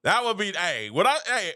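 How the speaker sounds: ordinary talk, nothing marked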